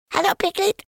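very high little squeak. Acted. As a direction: squeak it like a tiny joey. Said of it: "he squeaked".

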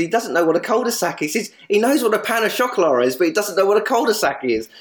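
high, amused retelling of a ribbing; gleeful teasing Nigel. Retold or imitated. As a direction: lift the pitch well up and relish the joke. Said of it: "Nigel said".

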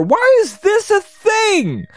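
high-pitched, drawn-out disbelieving wail; exasperated outcry broken by a sigh. Real, not acted. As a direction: plead for an answer, voice way up. Exasperated outburst at the game.

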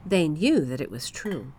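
plain reading voice

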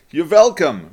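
put-on accented punchline, "welcome" starting with a V. A narrator's reading. Helpful stranger answering the husband's thanks, V-for-W accent.

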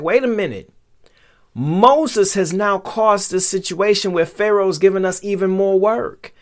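high-pitched crowd complaint; indignant objection. Acted.

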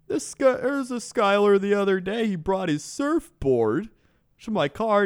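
high mock Canadian rant; Canadian accent. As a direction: rant like a baffled Canadian driver. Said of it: "need you to use that accent".

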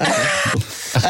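very high, drawn-out okay; surprised okay. Retold or imitated. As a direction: squeak out a startled okay. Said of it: "I was like".

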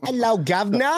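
high, hammed-up British greeting; mock British accent. Host proving his British accent to co-host, high mock British. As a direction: put on a cartoon British accent, pitched high. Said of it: "That's his British accent".

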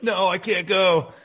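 retold tearful protest, loud and drawn out; hammed-up, distraught refusal; mock tearful. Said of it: "like, crying".

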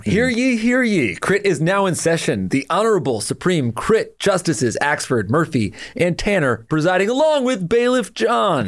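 booming court crier; mock royal decree. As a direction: proclaim it like a herald. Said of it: "so strong, that Bailiff John".

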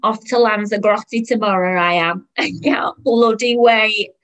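loud, gleeful bragging; excited, stretched-out delight; squealing excitement. Acted. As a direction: crow about your holiday to a stranger. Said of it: "she squealed".

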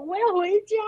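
high-pitched panicked mock yell; yelling to go home. On the spinning ride, begging to go home, high panicked yell. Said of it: "我直接在那个椅子上大喊中文".

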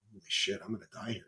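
hushed, shaken inner panic; muttered dread. Retold or imitated. Retold private panic.

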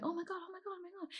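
high, hushed crowd flutter; quiet high-pitched murmur of amazed bystanders; murmuring onlookers. Retold or imitated. Onlookers whispering as he proposes, high hushed murmur. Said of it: "people are going".

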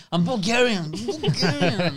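stretched-out Bulgarian, laughing; correcting, amused. Real, not acted. Comedian correcting a guest who called him Romanian, laughing. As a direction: insist on Bulgarian, slowing the repeat. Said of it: "'cause you're Romanian".

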